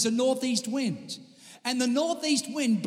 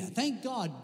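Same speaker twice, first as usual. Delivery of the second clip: ordinary talk, nothing marked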